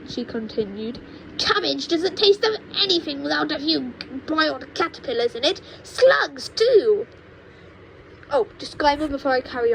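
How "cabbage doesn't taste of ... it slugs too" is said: put-on high, cranky old woman lecturing; cranky grandma. Grandma scolds George about growing, in a high cranky voice. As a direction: scold like a mean little granny.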